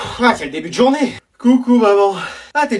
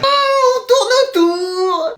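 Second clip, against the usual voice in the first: high drawn-out hint; 12 semitones higher, much slower than usual. Acted. Teacher hinting.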